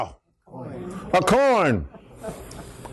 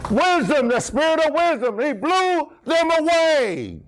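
higher-pitched, exultant preacher's cry; exultant preaching; 6 semitones higher than usual. Real, not acted. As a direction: preach it out high, drawing out the last words. Preacher exclaims in triumph.

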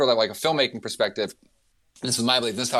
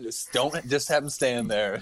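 usual speaking voice

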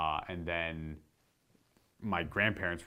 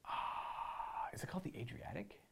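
whispered aside; 5 semitones higher, 12 dB quieter than usual. Real, not acted. Unsure, asking himself.

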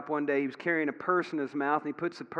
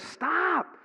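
high, astonished cry of stop, then excited; amazed bystander calling out; amazed onlooker; 7 semitones higher, 4 dB louder than usual. Retold or imitated. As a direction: blurt it out in wonder at the dog. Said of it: "he says".

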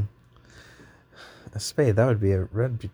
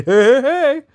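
cheesy hey hey hey; 7 dB louder than usual. Acted. Cain cheering in baby book.

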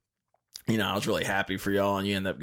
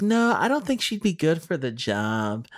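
put-on old lady voice; 8 semitones higher than usual. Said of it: "that's my old lady voice".

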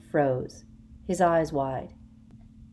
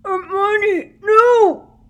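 high, very loud shout through a gag; muffled shout; 15 dB louder, much slower than usual. A narrator's reading. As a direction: yell it through cloth. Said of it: "shouted Ron through his gag".

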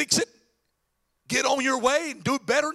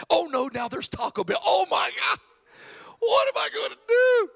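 hammed-up panic rising to a high, loud wail; mock panic; 7 semitones higher, 3 dB louder than usual. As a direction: melodramatic meltdown over fast food. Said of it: "Here's some of you".

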